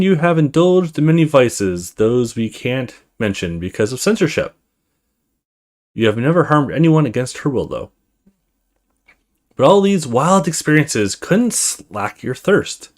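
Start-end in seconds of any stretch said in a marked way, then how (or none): none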